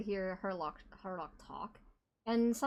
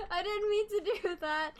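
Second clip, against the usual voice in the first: laughing apology; 10 semitones higher than usual. Real, not acted.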